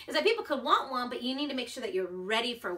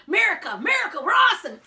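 loud, higher hammed-up chant of America; mock patriotic cheer; 8 semitones higher, 11 dB louder, faster than usual. Vlogger jokingly cheering for America after British envy, loud.

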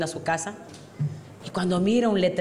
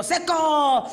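put-on party drunk; 4 semitones higher than usual. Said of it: "Y es el típico".

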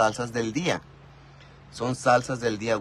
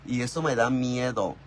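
normal talking voice

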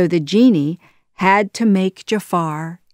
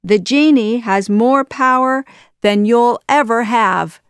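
higher, louder, emphatic taunt; 5 semitones higher, 6 dB louder than usual. A narrator's reading. Aladdin tricks Jafar about the genie, loudly taunting. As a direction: goad the villain boldly. Hero taunts villain after laughing.

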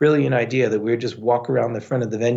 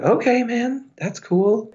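much higher, easygoing mock compliance; placating reply; 12 semitones higher, slower than usual. Drinker answers bouncer who grabbed him, placating. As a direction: back down in a high, agreeable voice.

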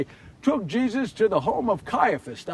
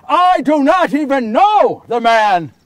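loud, higher-pitched emphatic shout; shouted denial; 4 semitones higher, 10 dB louder than usual. Acted. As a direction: shout the accuser down. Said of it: "I shouted at him".

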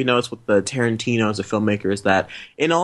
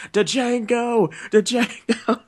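high-pitched hillbilly drawl calling the name, much higher; mock hillbilly; 11 semitones higher, much slower than usual. Hillbilly gunman calls out to Django, mocking, high hillbilly voice. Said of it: "that one hillbilly is like".

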